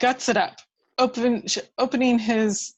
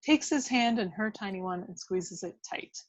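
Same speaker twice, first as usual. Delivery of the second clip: ordinary talk, nothing marked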